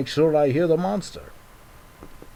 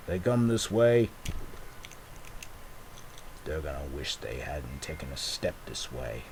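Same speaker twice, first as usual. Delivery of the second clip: much lower and softer, a quiet menace; menacing low threat; 8 semitones lower, 8 dB quieter than usual. Pretend. Jack vowing revenge on the monster, low menace. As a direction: growl it low like a tough hero.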